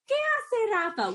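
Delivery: parentese. Real, not acted. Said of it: "my voice just went up".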